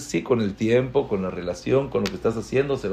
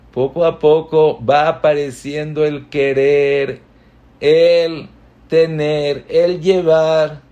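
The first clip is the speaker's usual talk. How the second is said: louder, building with each phrase; rising and swelling; 5 semitones higher, 8 dB louder, slower than usual. Acted. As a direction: let the love swell up loudly. El querer va apareciendo, creciendo. (en aumento, cada vez más fuerte)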